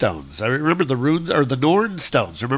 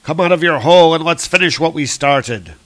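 loud, raised battle challenge; yelling; 4 semitones higher, 6 dB louder than usual. Acted. Young Thor challenging his foe into the open, yelling. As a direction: call him out with a high, loud shout. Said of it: "And he's yelling out".

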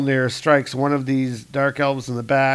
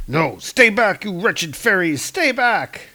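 raised pitch climbing to the end; alarmed warning; 7 semitones higher, slower than usual. Acted. Pinned dark elf to approaching fairies, alarmed warning. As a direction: ward them off in mounting alarm.

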